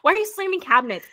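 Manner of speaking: mock exasperated partner. Spouse confronting partner over slammed cabinets: high, fast mock demand.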